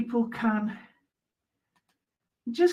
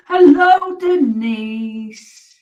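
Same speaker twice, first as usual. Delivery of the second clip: cheery hello; 4 semitones higher, 11 dB louder, much slower than usual. Real, not acted. Greeting viewer by name.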